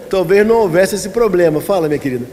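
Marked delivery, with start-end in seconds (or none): none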